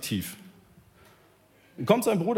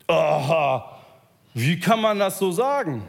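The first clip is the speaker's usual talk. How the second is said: louder, scandalised aha; indignant objection; 6 dB louder, slower than usual. Retold or imitated. Theologians protest against Jesus, scandalised. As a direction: bristle at the outrage.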